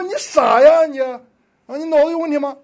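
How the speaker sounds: high, loud mocking jeer; passers-by jeering at the kneeling man; mocking scorn. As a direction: sneer at the fool. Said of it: "他们说".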